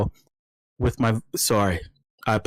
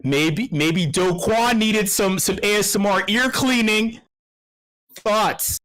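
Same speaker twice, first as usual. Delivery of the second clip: getting worked up; 8 semitones higher, slower than usual. Real, not acted. Host ranting about Do Kwon, higher and slow. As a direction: build up heat, drawn out. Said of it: "I'm getting emotional".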